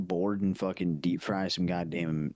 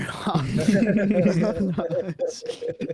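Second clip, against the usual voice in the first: high burst of laughter; loud laugh; 12 semitones higher, 7 dB louder than usual. Real, not acted. Big laugh.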